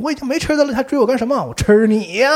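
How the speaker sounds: high, loud frightened yell; panicked shout. Retold or imitated. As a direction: scream it while running away. Monster chasing, mother shouting. (被追着时惊恐大喊)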